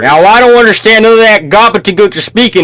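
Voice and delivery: loud, high hammed-up Southern accent. Acts out intolerant American answering accent.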